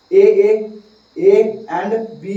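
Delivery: ordinary delivery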